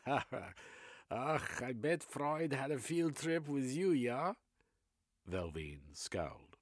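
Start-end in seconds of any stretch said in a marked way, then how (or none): 0.1-4.3 excitable German psychoanalyst, high and loud; German accent. Acted. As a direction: Reich in a German accent, eagerly expounding.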